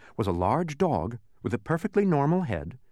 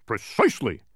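shouted. A narrator's reading. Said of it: "shouted the dog".

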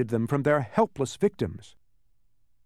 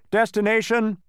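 high, loud official demand; shouted; 8 semitones higher, 6 dB louder than usual. A narrator's reading. Census taker demanding the destination, shouted. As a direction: call it out like an official. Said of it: "he repeated".

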